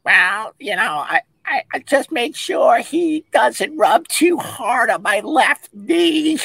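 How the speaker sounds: high, slow, drawn-out prim lady voice; fussy spa lady. Retold or imitated. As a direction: play up a precious lady fussing over her knee. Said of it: "this lady's like".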